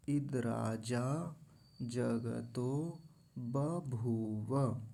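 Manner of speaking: slow word-by-word mantra recitation; pronunciation demonstration. Shown as a demonstration. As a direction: pause after each word, every syllable full. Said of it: "फिर से उच्चारण देखिए".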